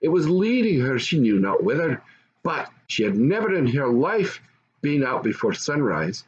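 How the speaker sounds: plain reading voice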